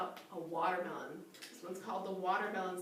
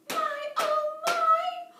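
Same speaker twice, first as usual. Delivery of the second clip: much higher gasping oh my; surprised; 8 dB louder, much slower than usual. Acted. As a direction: gasp in delighted surprise. Surprise at the door.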